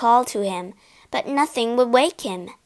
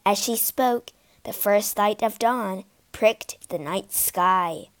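ordinary delivery

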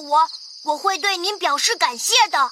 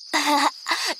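shy giggle; 3 semitones lower, 3 dB quieter than usual. Acted. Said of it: "笑了笑".

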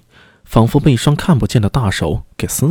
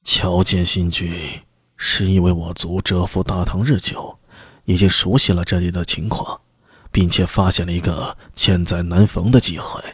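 low, hushed scheming voice; secretive envoy; 5 semitones lower, 5 dB quieter than usual. Acted. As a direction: confide the plan low and quiet. Envoy reveals plan.

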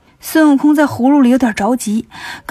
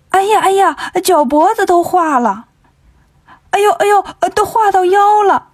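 high, hammed-up wailing of alarm; feigned cries; 7 semitones higher than usual. Wukong in the gourd pretends to melt, hammed-up high wails. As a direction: fake being in agony, pitched way up. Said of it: "就假装喊".

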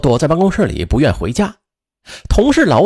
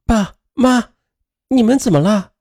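put-on young daughter voice; 7 semitones higher than usual. A narrator's reading. Daughter woken, calling at door.

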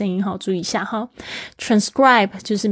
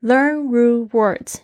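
slow, deliberate English; slow careful reading; 3 semitones higher, much slower than usual. Shown as a demonstration. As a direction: read each word out slowly. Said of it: "我们再来看一下这一招".

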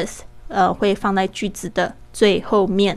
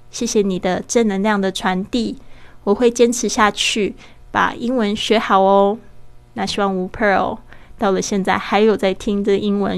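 normal talking voice

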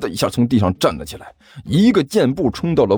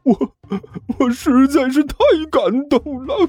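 blubbering with gratitude, rising to a wail; tearful, overwhelmed; 5 semitones higher than usual. A narrator's reading. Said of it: "一滴泪水终于顺着武坚的眼角滴".

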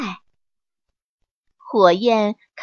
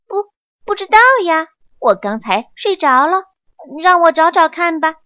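higher, louder, fast sleepy-innocent monkey; feigned innocence; 6 semitones higher, 5 dB louder, faster than usual. A narrator's reading. Monkey fakes innocence to the master, high and fast. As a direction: play dumb, rubbing your eyes. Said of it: "假惺惺地".

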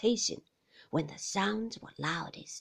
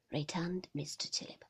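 ordinary delivery

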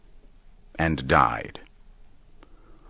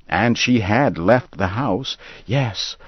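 ordinary delivery, nothing marked

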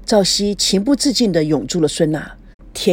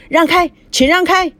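high, loud call to clear the way; urgent shout; 10 semitones higher, 5 dB louder than usual. Acted. Doctors pushing a stretcher shout at the crowd, loud and urgent. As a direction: yell at people to move aside.

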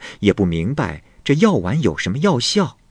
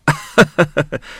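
laugh; 8 semitones higher, 3 dB louder than usual. A narrator's reading. Huagu laughs at the young man.

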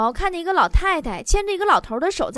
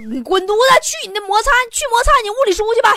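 mock scolding; 7 semitones higher, 5 dB louder, faster than usual. Girl scolds nerdy boyfriend.

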